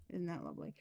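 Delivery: soft, dry sarcasm; sarcastic. Real, not acted. Reader reacting to financial fraud, quietly sarcastic. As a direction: toss it off quietly, meaning the opposite. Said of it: "I'm being sarcastic".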